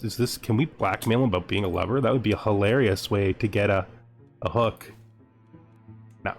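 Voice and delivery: normal talking voice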